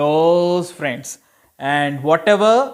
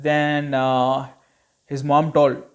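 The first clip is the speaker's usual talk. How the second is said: ordinary delivery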